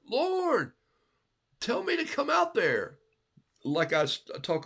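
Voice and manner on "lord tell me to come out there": high, eager asking; eager plea. Retold or imitated. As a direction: beg to join him, excited. Peter voiced eagerly.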